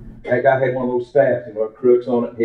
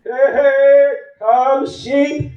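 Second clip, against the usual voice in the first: shepherd holler; much slower than usual. Retold or imitated. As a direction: holler across the field for the sheep. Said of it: "And he had a certain holler".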